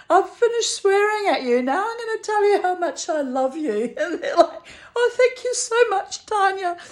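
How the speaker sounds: high-pitched giggly retelling, breaking into laughs; helpless high giggles while talking; hysterical laughter. Real, not acted. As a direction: tell it through real, rising hysterical laughter. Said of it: "I'm getting a little bit hysterical".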